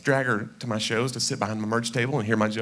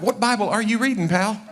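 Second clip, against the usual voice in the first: higher-pitched mock disbelief; incredulous challenge; 8 semitones higher than usual. Someone challenging the man who quoted scripture, incredulous. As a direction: challenge it with mild sarcasm.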